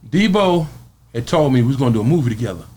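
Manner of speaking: ordinary talk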